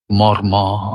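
মর্মর low and evenly drawn out; soft low glide. Shown as a demonstration. Reciter showing wind through leaves, soft gliding মর্মর. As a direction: let মর্মর roll gently in a low register, no stress. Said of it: "মৃদু মতন শব্দ ওঠে".

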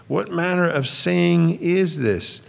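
high, slow, puzzled quoting; perplexed question. Acted. Preacher voicing the crowd puzzling over Jesus' words, perplexed.

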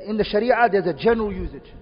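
usual speaking voice

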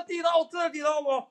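尖高嗓门复述自己的抬杠; 拔高嗓子质问，像在跟人抬杠; 高声反问. Retold or imitated. 复述跟人抬杠时的反问. (扯高嗓子反驳)

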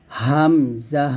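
হামজা started in the throat, long syllables; Hamza from the throat. Shown as a demonstration. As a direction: bring হামজা out slowly from the throat. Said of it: "এইভাবে বের করব".

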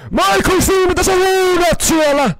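very loud, high, drawn-out holler; long high-pitched yell; shouted call. Pretend. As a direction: bellow to someone far away. Calling across to Michael.

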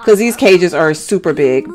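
normal talking voice

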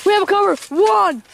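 excited high play-by-play call; wrestling commentator. Acted. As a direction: call the match live, rising hype.

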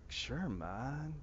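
lightly put-on movie accent, softly said; a hint of a film-star accent, quiet; Keanu Reeves. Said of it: "it was Keanu Reeves or something".

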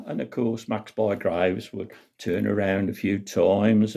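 ordinary talk, nothing marked

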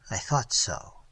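whispered, low under the breath; muttered. A narrator's reading. Trent muttering as he recognises the boy. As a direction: mutter it to yourself. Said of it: "he muttered".

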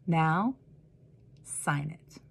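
tender, with a smile; smiling, gentle. Acted. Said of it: "she smiled and said".

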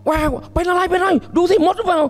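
put-on high, anxious mother checking a crying baby; worried mother. Mother frets over her crying baby, high and anxious.

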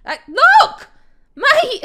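high, loud yelp at a zombie; startled shout. Real, not acted. Player startled by a zombie, loud high yelp. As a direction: yelp in alarm.

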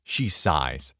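usual speaking voice

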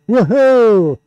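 loud, high, drawn-out whoop; celebratory whoop. Real, not acted. Host appears on overhead camera, celebratory whoop. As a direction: let out a big cheer.

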